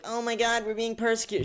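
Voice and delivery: high-pitched mock outrage; mock victim. Mocking believers who claim persecution, high-pitched complaint. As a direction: imitate someone crying persecution. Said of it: "and you're like".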